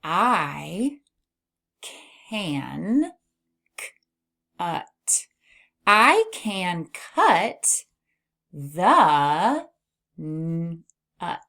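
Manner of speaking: very slow, each word on its own; word-by-word reading. Shown as a demonstration. Teacher reads aloud for beginners, word by word. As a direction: stretch every word, pausing between. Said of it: "All right, let's read".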